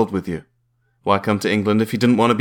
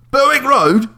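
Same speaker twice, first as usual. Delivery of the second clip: high, loud Cockney mishearing; aggressive Cockney; 5 dB louder, slower than usual. Acted. Cockney landlady mishearing the caller, aggressive. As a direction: blurt it back in Cockney. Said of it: "aggressively Cockney".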